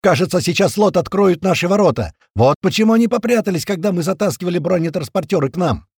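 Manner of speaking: loud, high stream of orders; frantic yelling. A narrator's reading. Narrator calling everyone to arms, frantic yelling. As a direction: bellow commands to the whole hall. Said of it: "я орал, как потерпевший".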